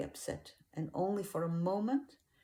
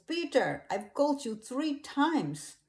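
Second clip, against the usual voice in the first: higher, louder urgent plea; reproachful call, pitched up and forceful; 5 semitones higher, 6 dB louder than usual. A narrator's reading. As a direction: rouse them with raised, insistent voice. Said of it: "And he calls".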